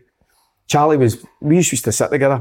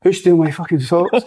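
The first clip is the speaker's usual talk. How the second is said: higher, angry mock shout; furious demand; 6 semitones higher than usual. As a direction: storm in demanding who took them. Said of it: "came storming in".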